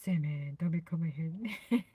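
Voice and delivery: low, put-on deep voice. Shown as a demonstration. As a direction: drop down low, playfully. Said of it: "in your deep voice".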